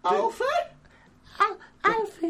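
very high mocking nana call; Nana Moon. Heckling the Bane attempt, Nana Moon voice. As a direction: pipe the name up high, teasingly. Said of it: "Sounds like Nana Moon".

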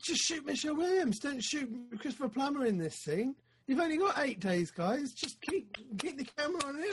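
high-pitched, acted plea to a film crew; exasperated pleading. Imagined exasperated advice to film crew.

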